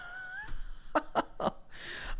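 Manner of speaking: very high, voiceless squeal of laughter. Real, not acted. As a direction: shriek with delight. Sudden delighted squeal at panel.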